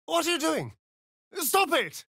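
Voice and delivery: high, panicked cry to stop; alarmed protest. Acted. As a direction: shriek at someone to quit it.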